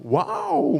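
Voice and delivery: very high excited "와우"; awed wow. Pretend. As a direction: gasp "와우" in delight. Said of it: "이러지 말고".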